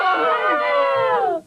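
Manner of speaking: sudden high scream; falling scream. Acted. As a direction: cry out as you fall. Coco falls from tree.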